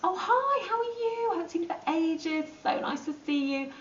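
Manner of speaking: overly sweet greeting. Retold or imitated. Greeting the person who slagged you off, sugary sweet. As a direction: gush over someone who hurt you. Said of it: "You go".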